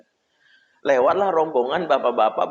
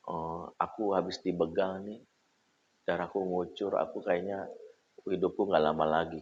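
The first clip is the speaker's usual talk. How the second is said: faint, hoarse, softer, like he's bleeding out; weak hoarse voice; 6 semitones lower, 10 dB quieter than usual. Retold or imitated. Boyfriend faking injury to the girl on the phone, weak hoarse voice. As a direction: fake a dying man's rasp, much softer. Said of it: "dengan suara parau".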